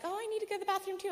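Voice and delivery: very high and rapid, oblivious; chirpy friend. Retold or imitated. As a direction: rattle it off brightly like a clueless friend. Other friend voiced.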